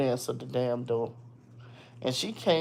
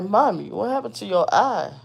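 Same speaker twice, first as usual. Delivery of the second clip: put-on child's voice; 6 semitones higher, 4 dB louder than usual. Son's voice.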